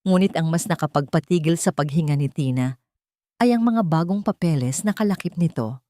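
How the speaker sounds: plain reading voice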